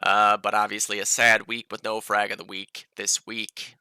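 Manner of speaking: normal talking voice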